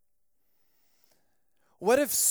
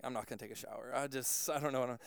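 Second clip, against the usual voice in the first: low, very soft, defeated mumbling; worn-out retold aside; resigned; 8 semitones lower, 12 dB quieter, much faster than usual. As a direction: give up quietly, under your breath. Retold giving up on shower.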